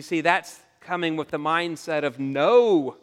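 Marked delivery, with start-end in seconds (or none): 2.2-3.0 louder, drawn-out "No!"; emphatic refusal. Acted. Preacher voicing the selfish mindset, emphatic refusal. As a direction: come down hard on the refusal.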